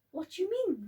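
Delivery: grandma aghast; shocked question. Acted. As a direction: pitch jumps up in disbelief.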